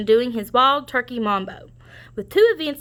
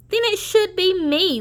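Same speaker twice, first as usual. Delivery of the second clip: lightly raised turkey voice; a cocky turkey, pitched up; high turkey voice; 9 semitones higher than usual. Acted. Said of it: "Wing said".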